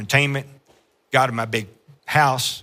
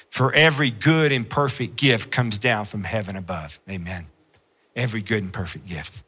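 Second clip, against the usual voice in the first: reverent quiet; 5 semitones lower, 5 dB quieter than usual. Acted. Quiet scripture reading.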